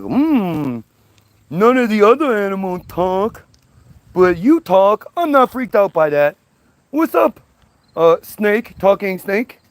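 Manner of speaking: high, airy ditzy voice; a hint of a dim Eve. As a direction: play her clueless and chatty.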